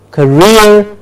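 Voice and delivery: career as "kuh-REER"; careful pronunciation. Shown as a demonstration. Teacher contrasting word pairs, careful "kuh-REER". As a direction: stress the end of career. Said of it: "Do you hear the difference?".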